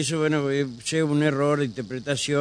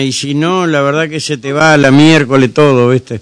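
raised voice, much louder; loud over bad line; 4 semitones higher, 15 dB louder than usual. Real, not acted. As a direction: call out loudly over a bad phone line. Shouting over a bad phone line.

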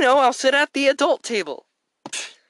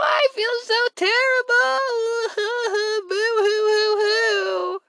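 crying; 7 semitones higher than usual. Acted. Fake crying over ruined dinner.

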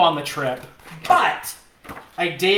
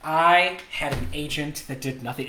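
ordinary talk

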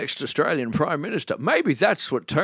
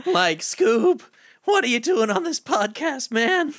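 much higher, laid-back cartoon stoner; Shaggy voice; 13 semitones higher, slower than usual. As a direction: drawl it like Shaggy talking to Scooby. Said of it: "sounding like Marty McFly".

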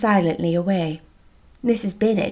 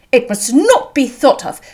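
loud, raised-pitch protest; earnest outcry; 5 semitones higher, 6 dB louder than usual. A narrator's reading. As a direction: insist emphatically as an indignant host. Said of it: "cried Bingley".

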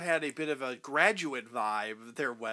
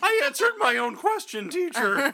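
playful schoolkid; 10 semitones higher, 3 dB louder than usual. Pretend. Playful kid to teacher.

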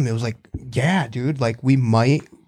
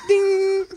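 bell ding. Acted. Bell sound effect.

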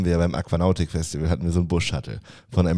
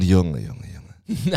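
exasperated sigh; much slower than usual. Real, not acted. Groaning at the pile of letters.

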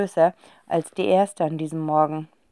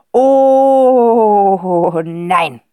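a hint of a dismayed father, first word pushed loud; the "Oh" loud, then "nein." dropping away; 8 dB louder than usual. A narrator's reading. Father dreading the countess's call, dismayed.

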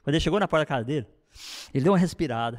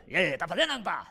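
quick, demanding interrogation; pushy check-up; faster than usual. Shown as a demonstration. Father grilling daughter about her devotional, pushy and fast. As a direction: rattle it off like an inspector. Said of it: "não é aquela coisa".

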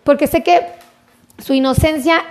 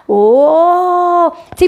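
long, louder, higher-pitched "oh"; delighted; 5 semitones higher, 5 dB louder than usual. Real, not acted. Host greeting a dear viewer from Peru, delighted drawn-out coo.

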